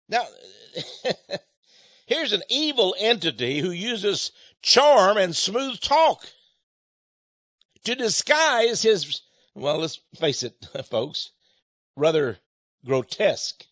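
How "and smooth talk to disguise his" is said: slow emphasis. Real, not acted. Savouring the words.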